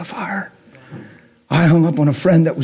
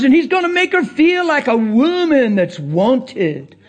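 urgent pulpit delivery, pitched well up; impassioned preaching; 8 semitones higher than usual. Acted. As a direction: preach it fervently, stretching the line endings. Preaches at full force.

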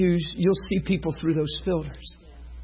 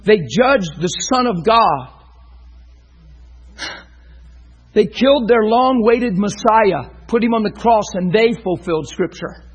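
loud, high preaching at full force; fiery preaching; 7 semitones higher, 6 dB louder than usual. Acted. Pastor preaching to the congregation, loud and fervent. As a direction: pour it out over the congregation.

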